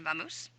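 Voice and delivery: "vamos" as English va-MOOSE; Anglicized "vamos", ending rhymes with goose; English vamoose. Shown as a demonstration. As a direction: say "vamos" with v and a long oo. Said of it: "heard the expression vamos".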